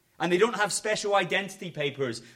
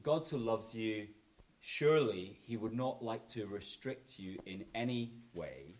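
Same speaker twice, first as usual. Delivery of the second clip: smooth false teacher; 6 semitones lower, 7 dB quieter than usual. Retold or imitated. Pastor mimicking false teachers lulling his flock, soft and soothing. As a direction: coax them gently to do as they like. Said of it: "they speak like this".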